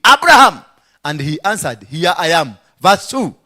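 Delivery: ringing sermon delivery; full-force preaching. Acted. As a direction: preach the verses out to the hall.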